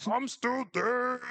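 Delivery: high, slow ghostly wail; Coach Martinez. Acted. Dead coach reporting in, drawn-out high ghost voice. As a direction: wail it long, a comic ghost. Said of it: "I can imagine the ghost of Co-, Coach Martinez going".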